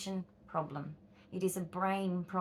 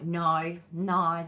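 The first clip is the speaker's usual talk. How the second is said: firm refusal; 3 semitones lower, 8 dB louder than usual. Retold or imitated. Rejecting "it's just the way it is.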